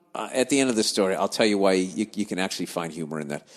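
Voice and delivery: ordinary delivery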